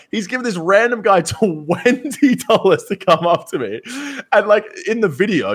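high and laughing through it; barely holding it together. Real, not acted. Still laughing about the prank.

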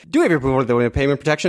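fast slurred legal mumble; rushed auctioneer patter. Retold or imitated. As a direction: rattle it off very fast. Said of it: "they would go like".

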